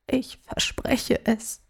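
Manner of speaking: put-on whispered, slow, high child's plea; breaking voice. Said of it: "beteuerte er mit brechender Stimme".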